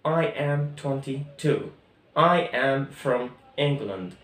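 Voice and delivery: each sentence said very slowly; teacher dictating word by word; slow, clear classroom drill; slow model sentences. Shown as a demonstration. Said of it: "الجمل كانت".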